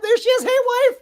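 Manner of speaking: high, loud playful call; greeting the wife as she sneaks past. Real, not acted. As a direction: sing out to her across the room. Calls out to wife off camera.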